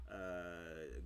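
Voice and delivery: normal talking voice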